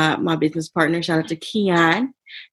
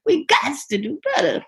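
higher-pitched mock British accent; hammed-up British accent; 9 semitones higher than usual. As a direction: put on a playful British voice. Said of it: "she's been talking in an accent".

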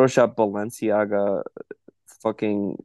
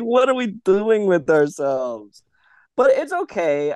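mock despair; 9 semitones higher, slower than usual. Real, not acted.

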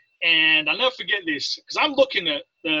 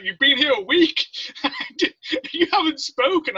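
laughing disbelief; 9 semitones higher, 3 dB louder than usual. Retold or imitated. Retold amazement at silent recruit.

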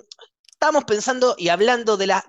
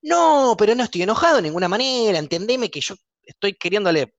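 heated fast denial; 3 semitones higher than usual. Shown as a demonstration. Replying to a friend who says calm down, fast heated denial. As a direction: rattle off the denial, pitch jumping. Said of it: "Así te respondo".

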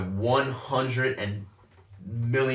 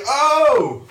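much higher, louder shocked cry; startled yell; 12 semitones higher, 8 dB louder than usual. Retold or imitated. Rider caught by the sudden drop, loud shocked cry.

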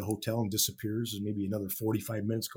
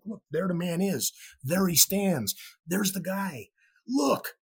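higher-pitched, excited retold callout; excited pointing-out; 8 semitones higher, slower than usual. Young fan telling friend there he stands, excited. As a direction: point him out eagerly in a higher voice.

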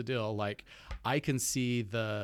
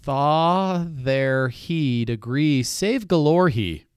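slow sounding out; 3 semitones higher, 9 dB louder, much slower than usual. Shown as a demonstration.

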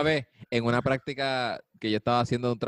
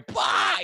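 mocking burst of laughter; 7 semitones higher, 5 dB louder than usual. Imitating boss's mocking laugh.

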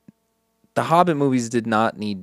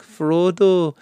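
higher, slower, hammed-up Pippin; Pippin impression; 8 semitones higher, 6 dB louder, slower than usual. Pippin cooing to Frodo, in a hammed-up high voice. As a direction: drawl it out fondly like a silly hobbit. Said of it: "a Pippin impression where he's like".

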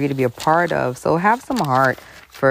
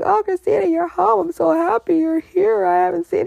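much higher, sugary greeting voice; mock uncle. Uncle greeting Christina home, high syrupy surprise. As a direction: gush over her like a fake uncle. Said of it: "He's like".

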